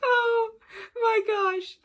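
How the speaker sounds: high, loud squeal through laughter; laughing squeal. Real, not acted. Cracking up over the book.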